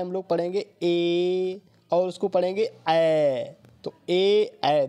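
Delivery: ए and ऐ spoken very slowly and drawn out; vowel sound demo. Shown as a demonstration. Teacher demonstrates two vowel sounds for students, slowly. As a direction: sound out ए then ऐ carefully, slow and deliberate. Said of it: "sound देखिए इसका क्या होता है".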